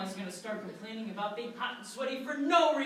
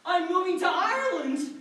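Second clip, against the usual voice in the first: high, loud, joyful declaration; elated cry; 6 semitones higher, 5 dB louder than usual. Acted. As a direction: shout the happy news. Celebrates winning the ticket.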